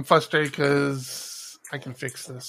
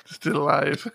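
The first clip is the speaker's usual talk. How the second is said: laughing; 5 semitones higher than usual. Real, not acted. Echoing a joke while laughing.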